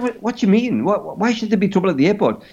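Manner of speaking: high, hurried, baffled questioning; mock panic. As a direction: demand an explanation in acted alarm. Acted alarmed questioning of friend.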